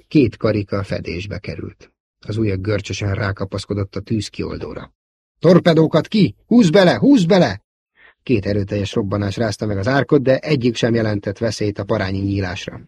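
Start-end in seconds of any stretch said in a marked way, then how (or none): 5.4-7.6 high, loud urgent yells; urgent shout. A narrator's reading. Luke firing torpedoes, yelling to pull out. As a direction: shout them to pull up now.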